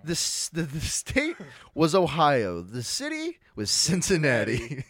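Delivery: slow, loud, mock-grand storyteller build-up; milk each clue for suspense; dramatic reveal. Telling friends the big cause, slow dramatic reveal. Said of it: "dramatic build-up to Harambe".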